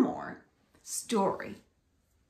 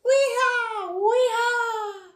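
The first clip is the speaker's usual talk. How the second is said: high, loud wee-haw; whooping monkey; 11 semitones higher, 4 dB louder, slower than usual. Acted. Monkey whooping as he swings on a vine.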